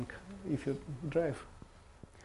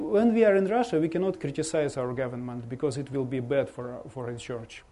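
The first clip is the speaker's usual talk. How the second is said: ordinary delivery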